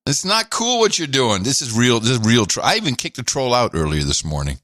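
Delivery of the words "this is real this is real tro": ordinary delivery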